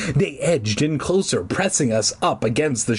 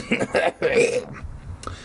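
coughing creature chuckle; dry snicker. A narrator's reading. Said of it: "more like a dry cough than laughter".

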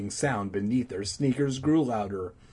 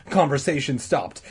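ordinary delivery, nothing marked

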